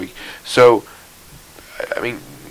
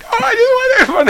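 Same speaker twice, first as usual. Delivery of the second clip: mock crying squeal. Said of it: "he's like".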